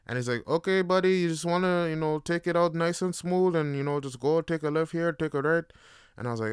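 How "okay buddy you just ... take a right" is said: lightly voiced driving instructor, pitched up; a hint of a chummy instructor, raised pitch; instructor's patter. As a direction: coax a new driver along in a higher voice.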